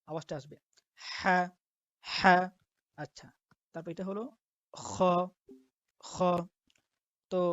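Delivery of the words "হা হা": Arabic হা from the throat; throaty ha. Shown as a demonstration. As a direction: breathe হা out from the throat. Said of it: "উচ্চারণটা হবে আপনার গলার ভিতর থেকে".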